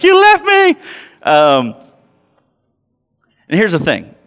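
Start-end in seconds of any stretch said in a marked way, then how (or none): 0.0-0.7 put-on very high, loud, tearful kid voice; a little boy crying out his accusation; wailing child. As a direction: whine it like a sobbing toddler. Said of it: "He's like".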